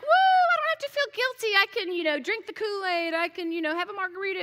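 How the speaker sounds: tossing off guilt with a gleeful woo; carefree whoop. Retold or imitated. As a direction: playfully ham up the freedom from guilt. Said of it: "and I'm like".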